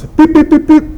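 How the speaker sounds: rapid-fire high mock impacts; imitated punch sounds. Retelling repeated punches, string of high beeps. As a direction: run of high quick beeps.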